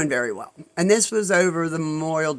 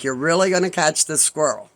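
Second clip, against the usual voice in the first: normal talking voice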